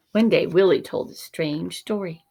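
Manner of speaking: plain reading voice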